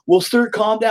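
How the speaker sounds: high-pitched mock soothing staffer; hospital staff. Staff to a screaming father, high mock placating voice. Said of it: "they were like".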